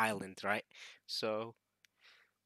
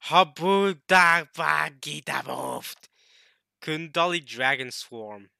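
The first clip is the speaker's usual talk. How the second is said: attack name callout; 3 semitones higher, 10 dB louder, slower than usual. Acted. Calling out Kaido's attack name.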